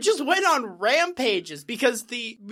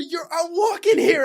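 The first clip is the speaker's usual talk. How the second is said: loud, higher New York tough guy; New York attitude; 7 semitones higher, 7 dB louder than usual. Retold or imitated. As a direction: throw it like a street tough.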